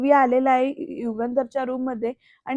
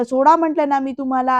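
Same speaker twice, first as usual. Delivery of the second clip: louder, acted angry insistence; shouting; 5 dB louder than usual. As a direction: repeat the order in a raised, cross voice. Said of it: "ओरडून बोलतोय बरं का".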